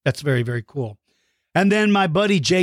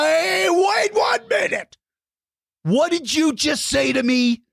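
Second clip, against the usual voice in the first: belligerent; 10 semitones higher than usual. Pretend. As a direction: confront them in hammed-up outrage. Mock outrage at being called El Stinko.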